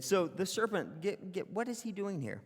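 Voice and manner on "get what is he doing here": usual speaking voice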